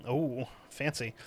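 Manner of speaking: soft, slow mock awe; mock impressed. Real, not acted. Mocking the baronet owner, soft and slow mock awe. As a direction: sneer it quietly.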